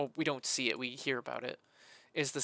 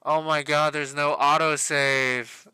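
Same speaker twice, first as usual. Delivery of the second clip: real loud dismay at losing everything; 12 dB louder than usual. Real, not acted. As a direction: shout the bad news at the screen. Discovers work is lost.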